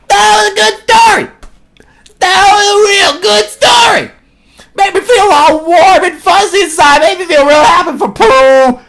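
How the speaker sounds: hammed-up shouted delight; put-on enthusiastic voice. Hammed-up verdict after the story.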